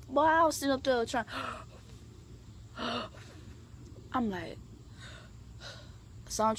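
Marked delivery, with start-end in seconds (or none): 2.2-4.1 breathless gasp for air; gasping for air while smothered; desperate gasp. Retold or imitated. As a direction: gasp like you're suffocating. Could not breathe under her.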